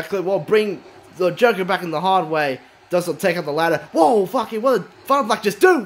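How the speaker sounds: usual speaking voice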